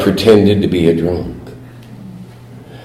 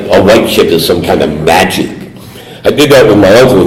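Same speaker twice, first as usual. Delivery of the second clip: normal talking voice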